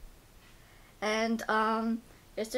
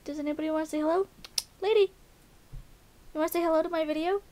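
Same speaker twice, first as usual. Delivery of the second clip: voicing the dog; 6 semitones higher, much faster than usual. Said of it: "She's like".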